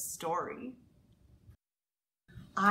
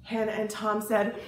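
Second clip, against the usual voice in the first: ordinary delivery, nothing marked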